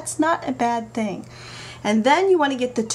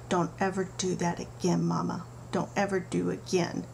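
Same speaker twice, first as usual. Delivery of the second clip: put-on soft grumbling toddler, much quieter; mumbled under breath; 4 semitones lower, 7 dB quieter than usual. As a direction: mutter it to yourself like a cross little girl. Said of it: "she mumbled under her breath".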